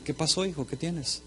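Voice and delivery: put-on soft, gentle father asking his sad boy; quietly asking the sad son what's wrong; tender fatherly question. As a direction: coax him softly, like a worried dad.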